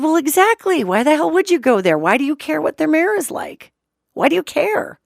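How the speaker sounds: high-pitched, pressing retort; insistent challenge. Retold or imitated. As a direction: press him with pointed rhetorical questions. Retold challenge to the farmer.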